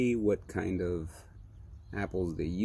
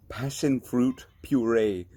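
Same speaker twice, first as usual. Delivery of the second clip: slow and louder, laughing through "passion fruit puree"; laughing tongue-twister; 4 semitones higher, 5 dB louder, slower than usual. Shown as a demonstration. Stumbling over the can's words for viewers, laughing. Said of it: "Try saying that three times quick".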